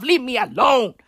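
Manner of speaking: ordinary talk, nothing marked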